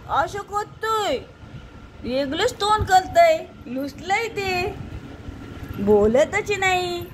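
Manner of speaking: put-on baby talk. Grandmother coaxing a sulking baby, sing-song baby talk.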